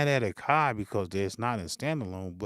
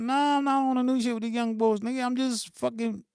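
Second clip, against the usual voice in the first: mock innocent reply; 9 semitones higher than usual. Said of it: "I'm like".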